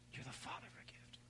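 barely audible whisper, as God's voice; hushed, voiceless whisper. Acted. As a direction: whisper it like a secret into the spirit. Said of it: "hear the whisper again".

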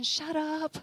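high, sing-out comic retort; playful mock retort. God silencing the preacher, playful high retort. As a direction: pitch it way up, teasing.

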